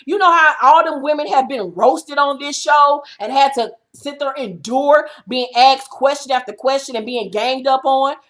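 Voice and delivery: normal talking voice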